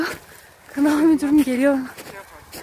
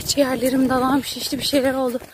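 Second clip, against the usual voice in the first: ordinary delivery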